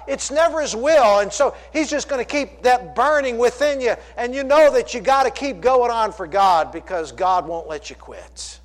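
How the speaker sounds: loud, high, insistent preaching; full-force exhortation. Acted. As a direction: hammer the point into the congregation.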